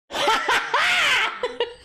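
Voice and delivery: high happy laugh; amused laugh; real laugh. Real, not acted. Laughs at the speed run.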